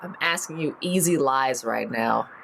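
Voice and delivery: ordinary delivery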